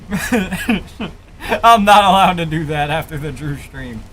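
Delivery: slow, laughing through the words; giggling while repeating it. Real, not acted. Same line said twice.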